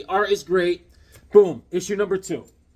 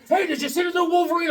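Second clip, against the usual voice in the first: put-on much higher, fast eager kid; 11 semitones higher, faster than usual. Vlogger imitates the young clerk stopping him, high and quick. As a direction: mimic a chatty young fan, quick and high.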